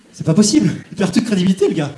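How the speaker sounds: usual speaking voice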